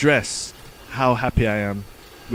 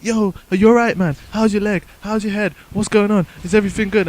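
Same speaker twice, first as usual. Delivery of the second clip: worried friends; 7 semitones higher, 4 dB louder than usual. Retold or imitated. Friends voiced around him.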